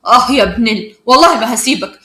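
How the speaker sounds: loud, furious threat; angry. A narrator's reading. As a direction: threaten the attacker angrily. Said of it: "آدم بغضب".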